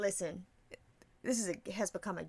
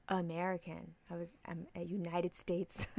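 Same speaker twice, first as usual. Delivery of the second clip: soft, slowed mock-innocent answer; feigned puzzlement; 8 dB quieter than usual. Host teasing someone asking ethnicity, softly playing dumb. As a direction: play dumb quietly and haltingly.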